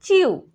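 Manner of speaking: "च्यू" lifted high with a clear yoo; teacher's high "च्यू"; ew sound demo. Shown as a demonstration. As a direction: pop out "च्यू" as the answer. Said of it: "इसको क्या पढ़ते हैं".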